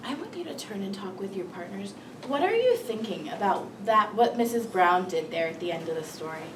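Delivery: ordinary talk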